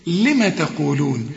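ordinary delivery

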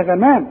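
غمام with thin, fronted vowels; wrongly thin Ghayn. Shown as a demonstration. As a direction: say غمام as "ghaemaem". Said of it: "كل دي غلط".

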